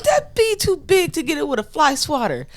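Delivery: high, crying protest; retold panic. Carmen protesting to her coworker, half-crying. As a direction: wail it in mock fear. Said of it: "I'm like".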